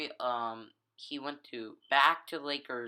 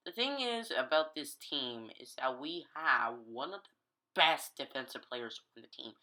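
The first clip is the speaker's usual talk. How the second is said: normal talking voice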